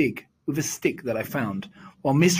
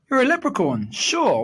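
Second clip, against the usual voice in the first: surprised; 8 semitones higher, 4 dB louder than usual. A narrator's reading. Boy spotting a leprechaun, high astonished exclaim. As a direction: pitch jumps high in amazement.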